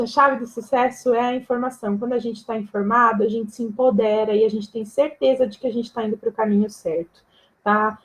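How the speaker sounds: ordinary talk, nothing marked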